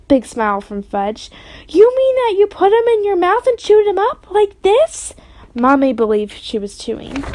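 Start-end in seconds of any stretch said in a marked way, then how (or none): 1.7-5.1 mother voice. Acted.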